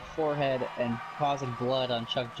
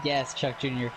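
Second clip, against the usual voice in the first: usual speaking voice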